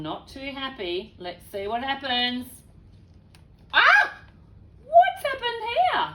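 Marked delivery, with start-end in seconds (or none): 3.7-6.1 high, loud gasp of surprise; shocked cry. Real, not acted. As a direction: react to the wrecked bathroom. Reader reacts to the mess.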